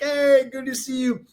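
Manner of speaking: high, cheery voiced greeting; imitating Mauricio. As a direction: play the friend greeting back. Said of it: "He's like".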